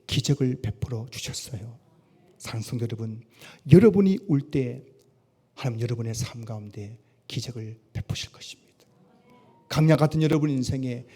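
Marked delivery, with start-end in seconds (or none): 2.4-3.2 soft address. Acted. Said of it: "address repeated at 45". 5.5-8.7 hushed, breathy whisper of promise. Acted. Pastor whispering comfort to weeping believers.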